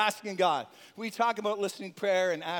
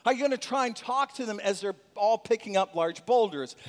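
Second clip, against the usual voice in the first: usual speaking voice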